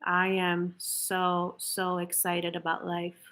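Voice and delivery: robotic, no feeling at all; flat robotic. Shown as a demonstration. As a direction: say it like empty words. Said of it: "So robotic, no feelings whatsoever".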